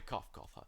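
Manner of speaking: breathy coughs into a faint laugh; voiceless coughs trailing into a ha; weak coughing laugh. Acted. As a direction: cough it out, spent and dying. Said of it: "His voice is hoarse".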